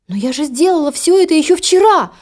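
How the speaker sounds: high, despairing protest; desperate cry. Acted. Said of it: "в отчаянии вскричала Золушка".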